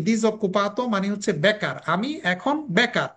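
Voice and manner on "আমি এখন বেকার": usual speaking voice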